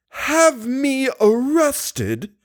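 put-on high, slow outraged old woman; shrill old woman. A narrator's reading. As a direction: spit it out like an indignant crone. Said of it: "The mouth that spoke those words seemed to spit".

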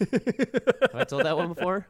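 slow, high and loud through laughter; laughing. Real, not acted. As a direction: ask it while cracking up. Laughing at repeated story joke.

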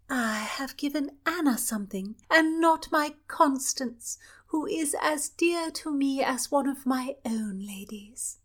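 put-on affectionate young royal; princess voice. Princess addressing Constance.

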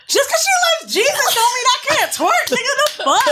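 shouting back at the bartender, indignant. Retold or imitated. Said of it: "I'm like".

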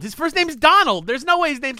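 loud, high-pitched insisting; heated protest. Real, not acted. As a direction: argue it loudly in disbelief. Heated protest about the name.

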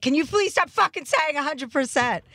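high-pitched, mock-irritated plea; retold exasperated outburst. Friend retelling her crabby outburst in South Africa, exasperated. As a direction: pitch it right up, fed up.

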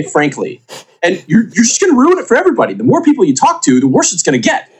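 heated, condescending Tina monologue, pitch climbing; indignant, interrupting tirade; Tina rant. Acted. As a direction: cut them off and lecture dismissively. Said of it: "I need to hear the Tina thing".

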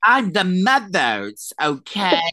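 high, loud, very drawn-out put-on voice; mock indignant announcement. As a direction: drag it out, hammed-up and loud. Hammed-up imitation of confrontation.

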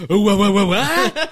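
stammered disbelief, rising high; stammering shock. Retold or imitated. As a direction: sputter in disbelief.

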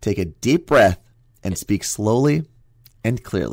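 very slow, careful reading; slow and deliberate. Shown as a demonstration. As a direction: read it slowly like a children's book. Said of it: "let me try this".

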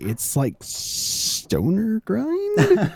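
unsure, very slow, rising question; hesitant. Retold or imitated. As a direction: imitate a nervous, doubtful guess. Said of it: "liked your hesitation while you're saying that".

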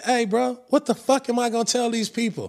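high-pitched mock disbelief; exasperated protest. Player retelling his answer to a demanding teammate, high exasperated protest.

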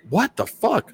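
high startled disbelief; shocked. Retold or imitated. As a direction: react like you just heard a crash. Shocked reaction to the fight.

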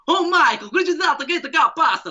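very high, loud, frantic mom voice; flustered Italian mom. Retold or imitated. Italian mom panics at her son's guest, high-pitched squeal. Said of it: "his mom starts flipping out".

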